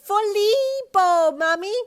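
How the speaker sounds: put-on high, loud, stretched child's call; happy shout. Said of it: "a loud voice called happily".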